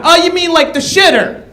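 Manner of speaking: high mocking "Oh"; mock questioner. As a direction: hand it back with a smirk. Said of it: "I should do the same thing".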